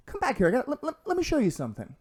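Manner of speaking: high, fast, eager invitation; eager manager. Retold or imitated. The manager calling him back, high and eager. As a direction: beckon him over, mock keen.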